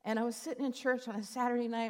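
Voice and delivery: normal talking voice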